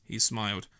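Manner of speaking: plain reading voice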